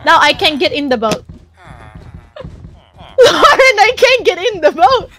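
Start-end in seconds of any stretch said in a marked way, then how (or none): 3.2-5.1 high, loud, tearful complaint; half-crying, high-pitched whine; whiny wail. Real, not acted. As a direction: cry out to a friend for help. Stuck outside boat, whines to Lauren.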